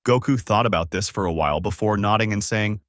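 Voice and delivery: ordinary delivery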